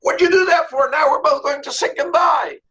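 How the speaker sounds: high, acted cry of indignation; outraged protest. Stung frog confronting the scorpion, high outraged protest. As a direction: demand an explanation, pitched right up.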